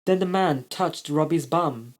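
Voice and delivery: usual speaking voice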